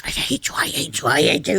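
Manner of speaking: whispered, teasing mock grudge; mock hateful Kissinger. Kissinger sulks across the room, a whispered mock grudge. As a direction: hiss it jokingly like a sore loser. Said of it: "Kissinger across the room is like".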